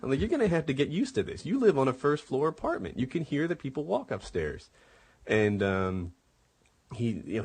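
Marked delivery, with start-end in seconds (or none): 0.0-4.7 heated retort. Retold or imitated. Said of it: "And I'm like".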